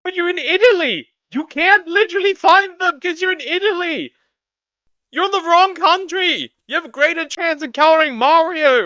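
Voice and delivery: high-pitched, incredulous yelling; exasperated outburst. Pretend. Streamer arguing about being in Italy, exasperated high yell. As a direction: yell it way up high, drawing out the key words.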